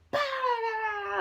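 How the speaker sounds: high, loud mock-shouted babble; mock yelling. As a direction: imitate a screaming match with nonsense sounds. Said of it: "huge scene, cursing".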